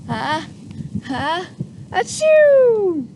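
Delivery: uh, uh, then a loud high achoo; mock sneeze. Shown as a demonstration. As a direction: lead the kids in a big sneeze. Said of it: "Do with me".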